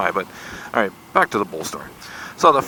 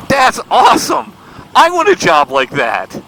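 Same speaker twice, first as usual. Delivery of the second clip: loud, high, gleeful exclamation; excited outburst; 11 semitones higher, 7 dB louder than usual. Retold or imitated. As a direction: blurt it out with huge enthusiasm. Quoting his own blurted enthusiasm.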